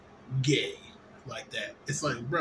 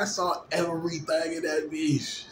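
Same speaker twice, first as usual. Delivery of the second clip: ordinary talk, nothing marked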